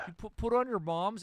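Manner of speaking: high, loud mock Toronto accent. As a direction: imitate a terrible Toronto accent. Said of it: "the worst accent you've ever heard".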